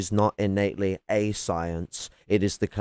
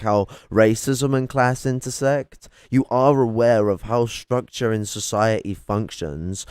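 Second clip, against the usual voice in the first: normal talking voice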